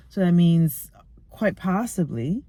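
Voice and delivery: ordinary talk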